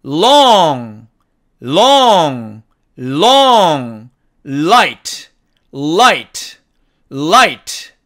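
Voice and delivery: loud, careful drills of each l word; long, light, life, liver, tail, call with the tongue pressed for l; clear l sound. Shown as a demonstration. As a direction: stretch each l with the tongue on the gum ridge. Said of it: "이 발음 기호의 소리를 한번 들어보도록".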